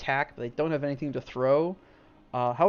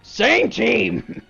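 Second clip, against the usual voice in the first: higher, louder grumbling protest; indignant giant; 8 semitones higher, 9 dB louder than usual. Acted. Giant repeats the protest to his ally, loud. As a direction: remind him they're allies. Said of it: "And he goes".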